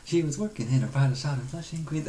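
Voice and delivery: a hint of the British dad from The Nanny; mock Nanny dad voice. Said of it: "talking like the dad on that T V show".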